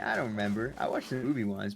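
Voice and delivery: usual speaking voice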